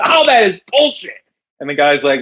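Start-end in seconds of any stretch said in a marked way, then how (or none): 0.0-1.1 high, put-on voice of an indignant barman; a bartender calling out a scam; outraged bartender.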